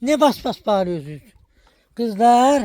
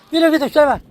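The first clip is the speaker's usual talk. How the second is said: high, louder shoo; shooing shout; 9 semitones higher, 4 dB louder, faster than usual. Real, not acted. Woman chasing off Süleyman, high loud shout. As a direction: send him off with a high shout.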